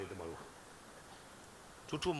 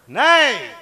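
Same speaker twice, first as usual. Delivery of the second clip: a sudden high, loud "નહીં"; emphatic no; 7 semitones higher, 18 dB louder, much slower than usual. Real, not acted. To the crowd about crows and ancestors, a loud flat denial. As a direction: slam the answer down like a verdict. Answering his own question.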